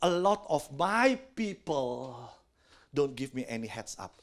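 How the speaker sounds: low, slow, soft divine voice; a hint of God gently complaining. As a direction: speak slowly and low as God. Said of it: "Tuhan ngomong".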